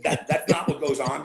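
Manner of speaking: laughing through the words; chuckling while protesting; amused laugh in the voice. Real, not acted.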